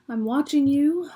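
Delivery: high, creepy whispered warning; playfully creepy. Acted. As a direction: whisper it loudly like a lurking watcher. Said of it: "A little creepy".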